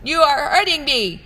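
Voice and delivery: high, loud desperate cry; cry of pain. Acted. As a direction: yell to be let go. Child caught by crocodile.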